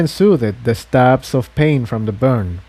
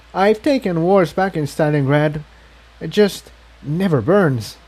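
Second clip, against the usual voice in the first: put-on higher young mare voice, putting on a brave face; injured Incendia; 6 semitones higher than usual. A narrator's reading. Incendia telling Tiptoe her leg is fine, higher brave voice. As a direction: sound higher, shrugging off the pain.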